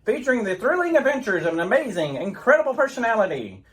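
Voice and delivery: grand announcer read of the Superman opening; old radio announcer. Acted. Radio announcer presenting Superman to boys and girls. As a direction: sell it like a vintage radio show.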